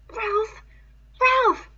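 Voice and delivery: high, rising frightened calling. A narrator's reading. Piggy gripping Ralph in fear, high frightened call.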